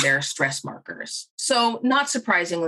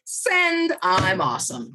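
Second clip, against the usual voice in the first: mock self-satisfaction; slower than usual. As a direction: imitate someone pleased with their own email. Mimics a self-satisfied email sender.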